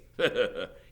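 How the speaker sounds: amused mocking laugh; sheriff's chuckle. A narrator's reading. Sheriff just slapped by the teacher, chuckling. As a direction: laugh off the slap. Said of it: "He laughed".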